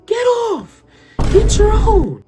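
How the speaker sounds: put-on high, loud bratty child yell; little girl shouting. Little daughter yelling at him to get off the raft. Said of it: "Guess what she said to me".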